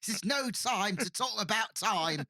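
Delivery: high, slow, slurred Cockney; Cockney Troi drunk, high and slow; mock drunk Cockney. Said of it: "go full Cockney suddenly".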